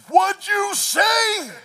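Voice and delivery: muscled guy confronting the driver; tough guy challenge. Retold or imitated. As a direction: square up and demand it.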